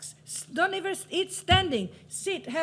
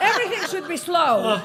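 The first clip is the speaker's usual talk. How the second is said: louder, said through laughter; laughing; 12 dB louder than usual. Real, not acted. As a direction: laugh while saying it.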